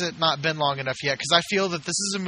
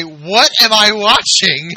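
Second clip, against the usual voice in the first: shouted; 5 semitones higher, 9 dB louder, much slower than usual. Retold or imitated. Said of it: "stand up in the theater and say".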